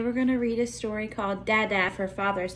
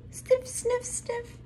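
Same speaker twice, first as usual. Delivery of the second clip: high, soft sniffing sounds; bunny sniffs; 14 semitones higher, 6 dB quieter, much slower than usual. Pretend. Teacher reading to kids, high soft sniffing.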